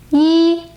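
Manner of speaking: "yi" held high and level; first tone. Shown as a demonstration. Teacher introducing the word for one: first tone on "yi", high level. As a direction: hold "yi" long on one high pitch. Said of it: "Yi is the first tone".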